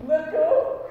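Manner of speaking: high, loud disbelieving echo; incredulous repeat. Acted. Man hearing God's answer, high and loud in disbelief. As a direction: echo it in shock.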